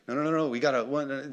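ordinary talk, nothing marked

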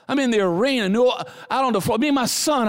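ordinary talk

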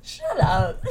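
playful shut up. Real, not acted. Playful shout at partner.

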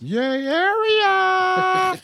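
long, high, loud drawn-out cheer; excited cheer. Real, not acted. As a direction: stretch it out like a fan in the stands.

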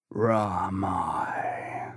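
low, soft murmur of a dying man; faint dying murmur. A narrator's reading. Dying Queequeg murmurs to the crew, low and soft. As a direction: breathe the word out low and faint.

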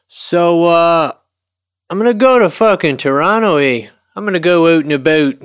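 put-on hamming up a Canadian accent for the listeners; bad Canadian accent. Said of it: "You're not doing a very good Canadian accent".

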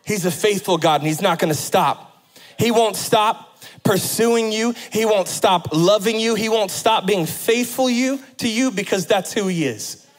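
full-force preaching. Acted. Pastor assuring the congregation of God's faithfulness, preaching hard. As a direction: press each promise home.

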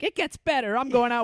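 high, loud mock proclamation; starry-eyed declaration. Kids from Vermont heading out, over-the-top defiant optimism. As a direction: announce it with hammed-up hopeful bravado.

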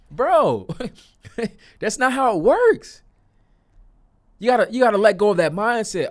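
high, loud exasperated scolding. Real, not acted. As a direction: call them out, fired up.

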